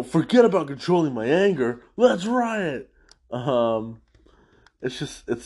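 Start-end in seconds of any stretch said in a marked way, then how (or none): 0.0-2.9 louder, riot jumps high; mock rally yell. The tough prisoner quitting calm to join the riot. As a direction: toss off the anger control with a lift. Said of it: "the guy follows up and goes".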